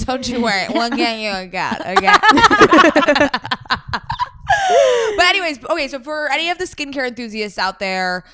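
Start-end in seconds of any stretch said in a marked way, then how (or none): 0.1-3.4 sweet, playful reassurance; soothing a customer, hammed up; mock reassuring. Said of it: "mock reassuring". 3.4-5.1 squealing laugh; high burst of laughter. Real, not acted.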